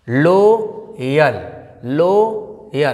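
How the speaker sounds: लोयल with a long "lo", pause, then "yal"; wrong reading, split. Shown as a demonstration. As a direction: say लोयल in two drawn-out syllables, "looo... yal". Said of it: "लो नहीं पढ़ेंगे यहाँ पे".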